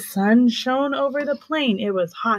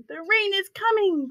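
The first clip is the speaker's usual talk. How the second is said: high, excited cry; cried out; 9 semitones higher, 4 dB quieter than usual. Acted. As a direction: call out the news high. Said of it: "cried the baboons".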